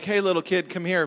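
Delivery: a hint of a stranger luring a child; creepy stranger voice. Preacher mimicking a stranger calling a little kid, lightly creepy voice. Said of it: "like, the, like ... voice".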